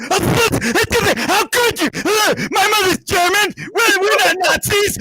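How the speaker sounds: mock-outraged rant, pitched very high; hammed-up shrieking indignation, words dragged out; Ryan. Imitating co-host upset about rooting for England, frothing outrage. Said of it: "Ryan foaming at the mouth".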